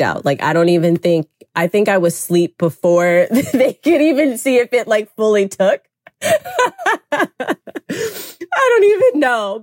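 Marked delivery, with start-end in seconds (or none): 3.3-9.6 talking through real laughter; high, amused laugh in the voice. Real, not acted. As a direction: crack up while telling it. Laughs at falling asleep so fast.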